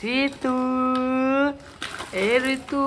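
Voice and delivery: high, drawn-out name call. Real, not acted. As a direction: call the name high, holding the last vowel. Calling the child by name.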